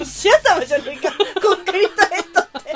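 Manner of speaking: high, loud words through laughter; laughing retort. Real, not acted. Co-host reacting to an absurd clue, laughing hard. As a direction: throw it back while cracking up.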